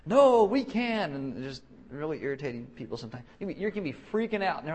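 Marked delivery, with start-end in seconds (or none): 0.1-1.1 high, loud, drawn-out protest; hammed-up believer's comeback; mock insistent optimism. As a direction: stretch it out, irritatingly sure. Imitates insistent faith enthusiast.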